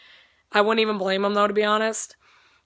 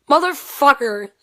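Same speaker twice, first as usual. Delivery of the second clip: louder, slower, higher frustrated curse; annoyed curse; 4 semitones higher, 6 dB louder, slower than usual. Retold or imitated. A fan finding the signing sold out, cursing in frustration. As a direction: drag out the curse, fed up. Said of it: "super pissed".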